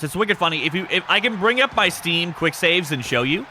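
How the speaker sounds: usual speaking voice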